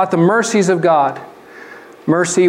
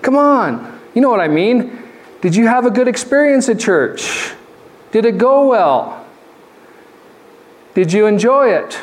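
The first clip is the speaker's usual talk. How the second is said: exasperated wife; 6 semitones higher than usual. Retold or imitated. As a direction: roll your eyes and insist. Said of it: "she's gonna roll her eyes and she goes".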